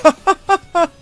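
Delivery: short high-pitched laugh at his own joke; quick amused cackle. Real, not acted.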